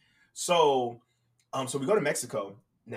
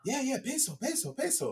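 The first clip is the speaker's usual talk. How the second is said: lightly voiced vendor, higher; a hint of an eager street seller; mild vendor impression pushing pesos; 10 semitones higher, 6 dB quieter than usual.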